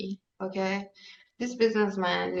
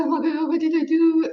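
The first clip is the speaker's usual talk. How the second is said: high-pitched retold wail; mock crying; 8 semitones higher, 4 dB louder than usual. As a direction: imitate a crying man, pitched way up. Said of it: "the businessman cry".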